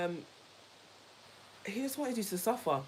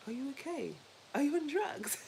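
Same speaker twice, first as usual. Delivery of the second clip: lightly voiced, higher worried outsider; mock concerned friend; 8 semitones higher than usual. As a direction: tease like a doubtful friend. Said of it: "they'll be like".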